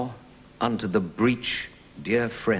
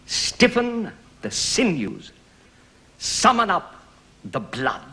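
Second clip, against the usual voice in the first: "Stiffen" and "Summon" pitched much higher and hit hard at the start; stress on Stiffen and Summon; 7 semitones higher than usual. Shown as a demonstration. Actor demonstrating Henry's pleading, "Stiffen" and "Summon" punched high. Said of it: "Stiffen and summon, strongly stressed".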